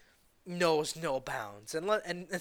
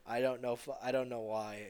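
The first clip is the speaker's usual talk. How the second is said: ordinary talk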